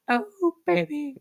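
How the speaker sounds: squeaky high voice crack. Real, not acted. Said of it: "that voice crack was".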